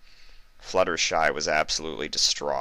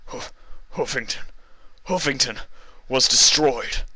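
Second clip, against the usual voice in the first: breathless, halting voice; out of breath; 8 semitones higher than usual. Acted. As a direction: gasp it out winded. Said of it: "the guard said out of breath".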